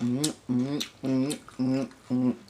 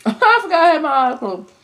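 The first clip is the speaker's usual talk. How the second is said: laughing; 9 semitones higher, 4 dB louder than usual. Real, not acted. Laughs at himself.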